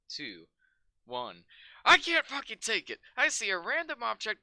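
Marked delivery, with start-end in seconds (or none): 1.8-3.0 loud, high, hammed-up despair; a ranting copypasta read, cranked up; mock unhinged outburst. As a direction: play it like you're losing your mind. Reading unhinged shitpost copypasta.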